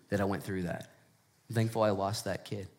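ordinary delivery

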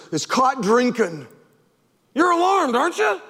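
ordinary talk

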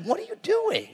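incredulous. Retold or imitated. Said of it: "He's like".

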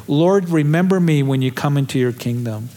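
usual speaking voice

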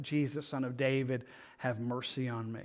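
drops low and soft for the blind man's plea; hushed retelling. Retold or imitated. Preacher softly re-enacts a beggar's plea. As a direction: pull it in close and soft.

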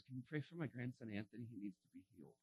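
very soft, low, fading request; hushed imitation of a grandmother's request; quiet retold plea. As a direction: ask softly, sinking almost to nothing. Retold grandmother asks for prayer.

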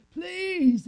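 high, loud begging shout; pleading cry. Acted. Joseph pleading with brothers above, high desperate shout. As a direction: beg at the top of your voice. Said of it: "I pleaded with them".

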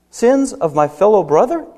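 ordinary talk

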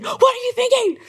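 high shrieking tirade; wife blasting her husband on the phone, shrieking; letting rip. Retold or imitated. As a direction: tear into him at full pitch. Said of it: "And I just let rip".

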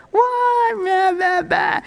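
put-on very high, childish wail; mock meltdown. Joshua having his little moment on the ground, mock meltdown. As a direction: wail it, dissolving into whiny babble. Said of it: "Joshua's having his little moment".

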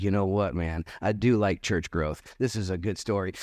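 casually talked into it; laid-back priest. Retold or imitated. Priest agreeing to join the tribe, low and relaxed.

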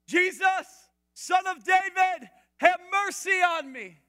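high, loud cry for mercy; desperate shout. Acted. Blind beggar shouting to Jesus over the crowd, desperate shout. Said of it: "he began to shout".

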